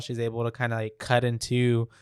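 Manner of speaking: normal talking voice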